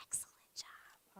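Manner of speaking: soft whispered praise to the class. Real, not acted. Teacher quietly praising kids, whispered. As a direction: whisper it to keep them close.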